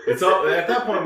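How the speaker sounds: laughing through the words; giggling while echoing the idea. Real, not acted. Laughing to friends about matching tattoos, giggling.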